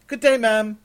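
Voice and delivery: high, loud formal servant voice; servant's greeting. Acted. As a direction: announce yourself loudly, pitched well up. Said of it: "said a servant to Raven".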